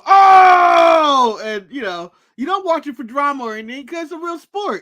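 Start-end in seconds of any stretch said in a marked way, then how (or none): 0.0-1.4 long, high, loud "oh!" of shock; crowd reaction shout. Retold or imitated. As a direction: holler a drawn-out fan reaction to a knockout. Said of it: "and going".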